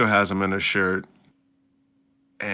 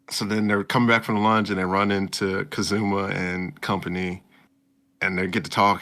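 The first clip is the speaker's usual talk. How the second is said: usual speaking voice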